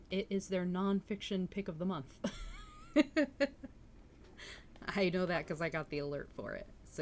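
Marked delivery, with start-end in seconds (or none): 2.2-4.9 unvoiced laugh. Real, not acted. Laughing at own bookstore alert.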